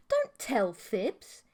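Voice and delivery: slow, sweet-voiced scold; coy scolding. A narrator's reading. Mantis girl gently scolds him, slow and sweet. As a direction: drag it out like a doting tease.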